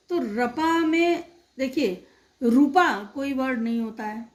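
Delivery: normal talking voice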